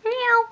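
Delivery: high cat meow; playful meow. Pretend. Finishing cat makeup, plays cat.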